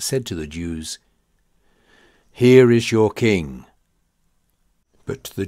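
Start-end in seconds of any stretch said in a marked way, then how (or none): 2.3-3.6 loud public proclamation; proclaiming. A narrator's reading. Pilate presenting Jesus to the crowd, loud. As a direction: announce him to the whole crowd, loud.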